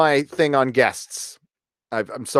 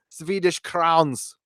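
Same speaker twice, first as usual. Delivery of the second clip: slow, raised German-accented delivery; 5 semitones higher, 5 dB quieter, much slower than usual. Shown as a demonstration. Thanking a viewer for money, in a German accent. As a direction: put on a German accent, drawing the words out. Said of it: "said it with a German accent".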